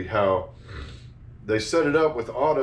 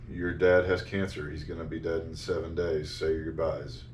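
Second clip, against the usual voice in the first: monotone doctor; 6 semitones lower, 5 dB quieter than usual. Retold or imitated. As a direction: deliver bad news like a detached doctor. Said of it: "monotone, unemotional voice".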